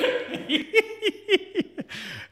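high, squeaky laugh; long helpless wheeze of laughter; wheezing laugh. Real, not acted.

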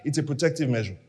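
dropped low and soft; soft aside. Shown as a demonstration. Preacher first says it softly to himself. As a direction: say it almost under the breath.

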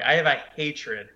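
ordinary delivery

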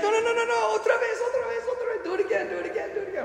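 very high, rapid pleading chatter; a small kid demanding again; insistent little girl. Retold or imitated. Girl begging for another coin toss, high childish pleading.

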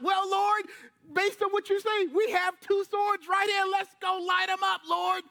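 eager disciples. Retold or imitated. Disciples offering two swords, eager and high. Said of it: "the disciples jump up".

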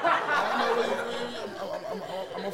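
stammering through laughter; words broken up by laughs; laughing. Real, not acted. Laughing while stumbling over words.

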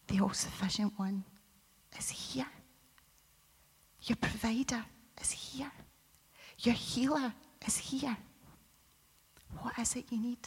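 soft whisper, almost no voice; whispered altar call. Acted. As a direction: lean in and whisper the promise to each listener.

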